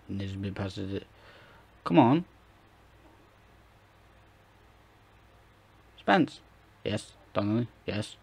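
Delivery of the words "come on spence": higher, louder urging call; excited shout. Real, not acted. Urging his striker on, loud excited shout. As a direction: yell at the screen for Spence. Cheering a chance in game.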